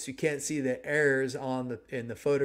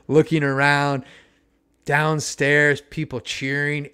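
ordinary delivery

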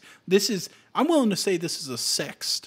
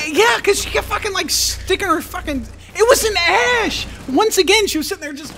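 excited; 8 dB louder than usual. Real, not acted. Laughing rant about enemy player.

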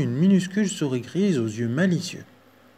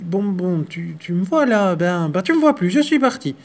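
higher stuttering squeak; stammering mouse; 5 semitones higher, 4 dB louder than usual. Acted.

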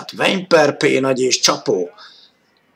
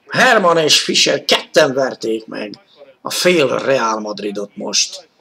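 normal talking voice

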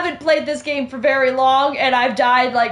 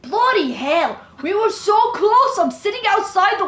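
British gone New Jersey, rising higher; botched British accent; 5 semitones higher than usual. Shown as a demonstration. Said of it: "some kind of British New Jersey shit".

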